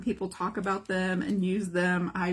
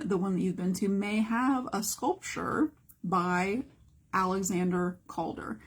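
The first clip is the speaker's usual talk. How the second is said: normal talking voice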